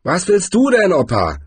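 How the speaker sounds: annoyed challenge, starting high; irritated growl. A narrator's reading. Gang leader turning on old Cohen, irritated growl. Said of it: "knurrte er".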